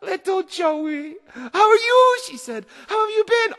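a tough pirate welling up; teary reunion. Acted. Stinky Annie greeting her lost little brother, teary. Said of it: "They'd never seen her cry".

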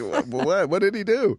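a stunned "what did he do?" mock outcry; baffled agent.

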